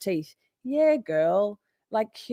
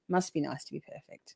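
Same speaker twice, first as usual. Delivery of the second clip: sarcastic aside; 4 semitones lower, 11 dB quieter than usual. Real, not acted. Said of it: "repeated jab at a commenter".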